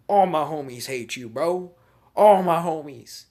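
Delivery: loud, high rallying shout; mock gang taunt. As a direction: holler it like a group beatdown chant. Chanting while kicking a foe.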